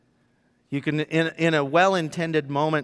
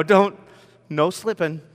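normal talking voice

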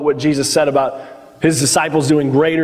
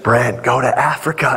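imitated divine command, lower voice; Holy Spirit; 4 semitones lower than usual. Preacher imagines the Holy Spirit calling him to Africa, low imitated voice. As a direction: put on a low voice for a calling from above. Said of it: "the Holy Spirit would be like".

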